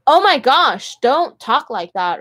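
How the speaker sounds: high, loud imitation of a shocked date; exaggerated appalled telling-off; mock scandalized. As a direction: scold in hammed-up disapproval. Imitating a date who scolds her.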